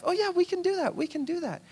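high, breezy overconfident yes; eager boast. Acted. Brothers assuring Jesus, high breezy overconfidence. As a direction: toss it off like it's easy.

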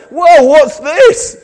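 slow, high, loud whoa of wonder; hammed-up newborn amazement; mock baby surprise. Preacher voicing a newborn, mock surprise.

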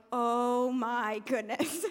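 slow, low, drawn-out mock swoon; tempted awe. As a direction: melt slowly into temptation, a laugh at the end. Acted giving in as dessert arrives.

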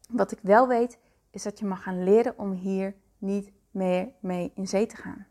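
ordinary delivery

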